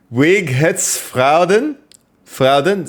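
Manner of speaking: slow, high, mock German attempt. Trying to answer chat in a lightly mock German. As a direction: sound out fake German slowly. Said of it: "triggered my inner German".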